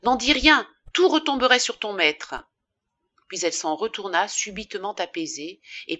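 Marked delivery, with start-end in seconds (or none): 0.0-2.3 high, loud, forceful command; urgent warning. A narrator's reading. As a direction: warn the boy hard to keep silent. Urgent warning to desperate boy. (mise en garde pressante)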